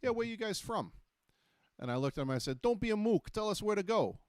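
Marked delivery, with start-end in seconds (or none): 0.0-0.9 a high, bright-toned New York street guy asking; lightly voiced New York stranger. And the guy goes. 2.6-4.2 high, louder mock New York tough guy; hammed-up New York accent, pitched up. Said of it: "start speaking back in that accent".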